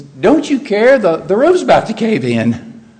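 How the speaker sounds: loud, high reproachful cry; acted rebuke. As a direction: scold someone lying on the couch as the roof gives way. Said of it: "Well, that's a rebuke".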